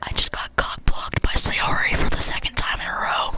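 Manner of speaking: slow exasperated whisper. Real, not acted. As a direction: whisper it in disbelief. Aside after game interruption.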